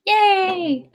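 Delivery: high bright yay; excited cheer. Real, not acted. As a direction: cheer it out happily. Cheering a viewer's thanks.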